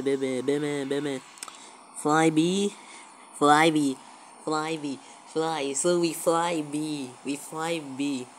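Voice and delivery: ordinary talk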